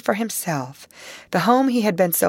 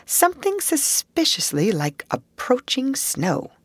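usual speaking voice

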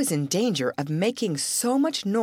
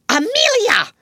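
high, loud outraged name; infuriated cry; 6 dB louder than usual. Acted. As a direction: bark the sister's name in fury. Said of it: "gasped her infuriated elder".

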